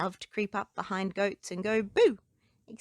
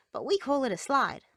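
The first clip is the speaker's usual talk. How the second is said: put-on cheerful boy; 5 semitones higher, 4 dB louder, faster than usual. Boy explains.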